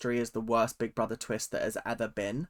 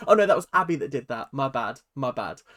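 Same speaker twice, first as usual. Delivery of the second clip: ordinary talk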